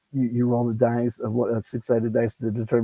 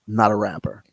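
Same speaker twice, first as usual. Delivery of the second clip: normal talking voice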